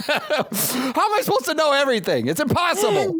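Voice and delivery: high, laughing, mock-outraged shout; exasperated protest. Real, not acted. Caster answers viewer calling him not smart, exasperated. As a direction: protest in a high comic wail.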